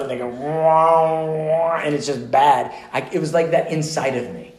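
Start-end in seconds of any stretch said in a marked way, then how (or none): 0.3-1.8 loud, held wail of a wrong note; clashing wrong notes. Shown as a demonstration. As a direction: imitate a jarring, off-key instrument. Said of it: "play the wrong note".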